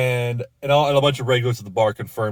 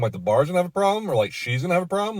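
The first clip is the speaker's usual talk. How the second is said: higher, quicker incredulous questions; fast, rising mock disbelief; incredulous questioning; 7 semitones higher, faster than usual. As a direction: press him, half disbelieving. Pressing the caller on a threat.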